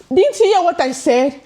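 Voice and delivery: high-pitched retold irritation; mock snappish shop assistant; suddenly snappish assistant. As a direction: imitate an assistant switching to a testy, high voice. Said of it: "she just switched mood".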